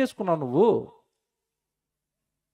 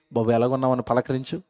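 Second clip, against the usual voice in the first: gentle greeting; 8 semitones lower, 5 dB quieter than usual. Acted.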